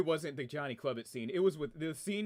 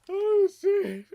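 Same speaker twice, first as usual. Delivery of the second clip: very high, loud laughing exclamation; shrieking laugh; 12 dB louder than usual. Real, not acted. Streamer losing it, very high loud laughing oh shit. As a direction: squeal it out through laughter.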